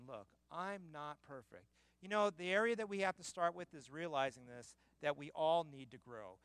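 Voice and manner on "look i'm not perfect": quiet confession. Retold or imitated. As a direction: say it softly, almost to yourself. Repeats quote softly after loud take.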